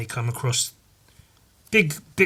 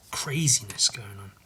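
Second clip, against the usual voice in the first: whisper; 4 semitones lower, 7 dB quieter than usual. Real, not acted. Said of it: "whispered aside".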